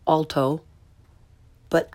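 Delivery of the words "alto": "alto" starting with English aw; learner's mispronunciation. Shown as a demonstration. Teacher shows second mistake, "alto" with English aw. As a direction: say "alto" as AWL-toh. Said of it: "first the way most people".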